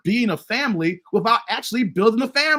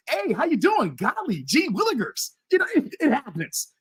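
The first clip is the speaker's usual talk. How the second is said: high, sing-song mock politeness; 5 semitones higher than usual. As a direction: put on a squeaky-clean, higher voice with swooping tune.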